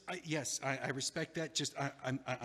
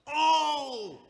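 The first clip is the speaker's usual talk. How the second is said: shocked gasp; 13 dB louder than usual. Acted. Shocked at news.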